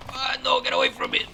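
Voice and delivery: very high put-on cry; mock panic. Player crying out during the game, mock panic. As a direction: squeal it in comic alarm.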